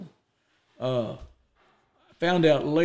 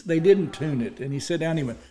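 ordinary delivery

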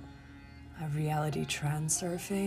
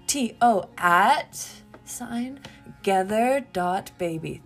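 loud, slow dictation with raised pitch; emphatic dictation; 4 semitones higher, 12 dB louder, slower than usual. Real, not acted. Podcaster repeating the email for listeners, loud emphatic dictation. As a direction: punch out each piece slowly.

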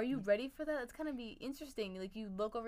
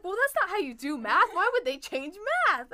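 higher and louder, a hammed-up indignant dad; mock exasperated dad; 8 semitones higher, 12 dB louder than usual. Imitating her dad scolding her math method, higher and louder mock outrage.

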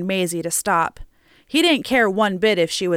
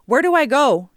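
higher, louder bewildered question; dumbfounded; 6 semitones higher, 5 dB louder than usual. A narrator's reading. Driver asking his passengers for direction, dumbfounded. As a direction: ask it at a total loss.

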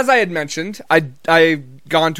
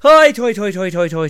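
high, loud start tumbling down in pitch; bouncy jolly chant, falling away step by step; Irish Santa laugh; 3 semitones higher, 5 dB louder than usual. Acted. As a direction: belt the first syllable, then let it cascade down. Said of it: "the Irish Santa will go".

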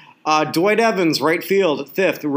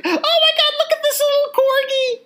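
much higher, squealing oh my God over a puppy; gushing over the corgi. Retold or imitated. Hotel clerk spots the corgi, ignores the rock star, squealing high. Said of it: "and then it's like".

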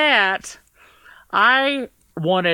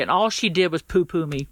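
normal talking voice

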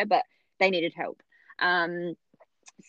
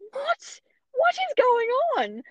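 much higher, squeaky what; baffled; slower than usual. Retold or imitated. Mother reacting to the calm obstetrician, squeaky and baffled. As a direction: shriek of confusion.

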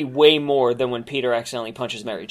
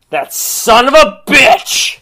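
high, drawn-out outraged yell; hammed-up furious shout; screaming commentator; 11 semitones higher, 6 dB louder, much slower than usual. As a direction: imitate a commentator screaming into the mic. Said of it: "Don Callis screamed in his microphone".

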